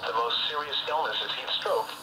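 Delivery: ordinary talk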